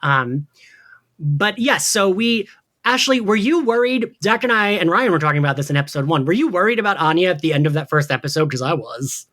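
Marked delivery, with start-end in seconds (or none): none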